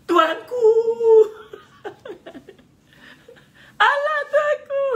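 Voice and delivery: high, loud mock wail of tuanku; wailing at the sultan. Preacher mocks a petitioner wailing before the sultan, high laughing cry. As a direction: play a petitioner howling at the king. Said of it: "nak minta apa-apa".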